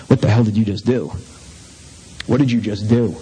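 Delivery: low, dismayed self-scolding; self-reproach. Retold or imitated. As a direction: scold yourself in disbelief. Retold self-reproach after drinking.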